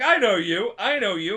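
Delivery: loud taunting recognition; loud accusing. Retold or imitated. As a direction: yell it like you've caught him. Yorveth recognizes Roche, shouting.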